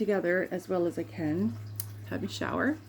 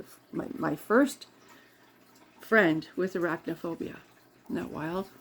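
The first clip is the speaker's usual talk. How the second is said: normal talking voice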